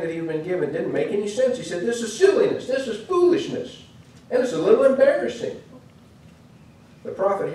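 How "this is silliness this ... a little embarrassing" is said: much higher, mocking disdain; scornful protest. Naaman scorns the washing command, high and derisive. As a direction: sneer at the order as beneath him.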